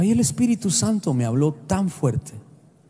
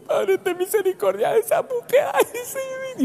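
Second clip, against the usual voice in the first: much higher, hammed-up teary plea; mock weepy woman. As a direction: overdo a woman sobbing over a show. Mocking a weepy TV-watcher.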